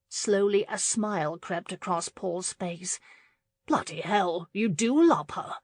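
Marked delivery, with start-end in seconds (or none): none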